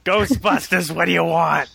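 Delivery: brash New York receptionist answering the phone; New York accent. Retold or imitated. Annie Potts answering the firehouse phone in New York accent. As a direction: snap it out like a New York switchboard girl. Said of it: "That's my best New York accent".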